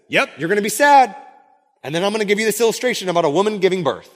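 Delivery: high, loud, brisk announcement; mock breezy bluntness. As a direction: deliver the bad news cheerily, speeding up. Voices Jesus bluntly giving bad news.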